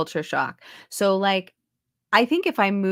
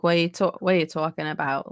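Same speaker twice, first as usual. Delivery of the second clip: put-on quick Brooklyn kid accent; New York accent; faster than usual. Shown as a demonstration. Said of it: "this sort of thing".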